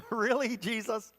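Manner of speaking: mock disbelief at a hard teaching; incredulous. Real, not acted. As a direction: act stunned and doubtful, asking Jesus.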